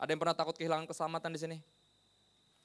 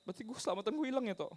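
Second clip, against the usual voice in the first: imitated tearful worry; 8 semitones higher, faster than usual. Preacher voices crying believer's question, mildly anxious. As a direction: act a man anxious and near tears. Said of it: "nangis, takut banget kehilangan keselamatannya".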